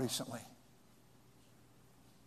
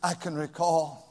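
ordinary talk

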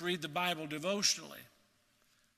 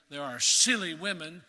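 SILLY punched louder in "silly women"; stress on silly; 5 dB louder than usual. Shown as a demonstration. Preacher shows how a verse might be read, stress on silly. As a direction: lean hard on the word "silly". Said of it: "place the emphasis on the word silly".

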